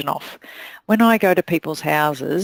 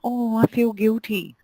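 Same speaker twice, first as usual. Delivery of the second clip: higher, small guilty whine; guilty timid voice; 5 semitones higher than usual. Retold or imitated. Timid stylist voicing guilt to a client, sheepish. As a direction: sound sheepish and apologetic. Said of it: "timid body language that says".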